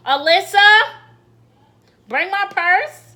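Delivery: loud, high call across the house, slow on purse. Real, not acted. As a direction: call the assistant loudly, slowly. Calling to the home assistant.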